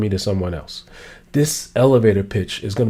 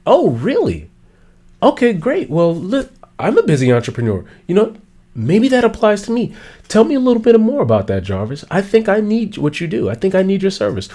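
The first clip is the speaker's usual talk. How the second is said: much higher, eager and curious; interested listener; 7 semitones higher than usual. Retold or imitated. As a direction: sound hooked and keen to hear more. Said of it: "now you're like".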